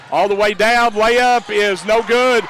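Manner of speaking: rapid-fire rising play-by-play; fast auctioneer rush. Real, not acted. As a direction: rattle it off like an auctioneer. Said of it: "you nearly became an auctioneer right there".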